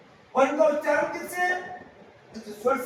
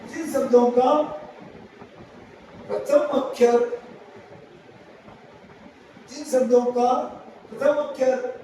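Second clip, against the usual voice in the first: phrase by phrase, long pauses between; 2 semitones lower than usual. Shown as a demonstration. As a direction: dictate the rule so students can write it down. Teacher dictating the next rule.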